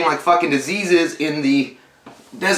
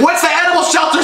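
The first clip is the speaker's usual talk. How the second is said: panicked outburst; 14 semitones higher than usual. Pretend. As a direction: yell it like you're losing it. Imagined freaking-out reaction.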